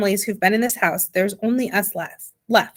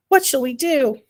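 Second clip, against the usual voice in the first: higher, understated cry of worry; heartfelt cry; 4 semitones higher than usual. A narrator's reading. Worried parent overheard at night, heartfelt cry. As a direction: plead it, a touch raised. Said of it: "the heartfelt cry of".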